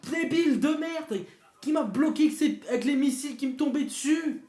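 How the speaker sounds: high, loud ranting; raging gamer. Real, not acted. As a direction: rant at his useless teammates. Ranting after losing the match. (joueur en rage qui peste fort)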